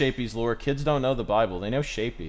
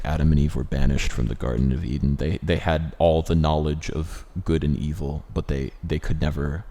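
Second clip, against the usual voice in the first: solemn narrator voice; 6 semitones lower, 3 dB louder than usual. Retold or imitated. Quoting the show's narrator.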